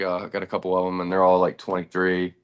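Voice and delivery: ordinary talk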